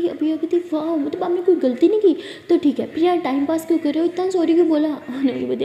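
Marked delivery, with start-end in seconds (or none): 0.7-4.9 loud, mocking indignation; sarcastic anger. Girlfriend angrily asking why he kept apologising, loud. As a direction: demand an answer, loud.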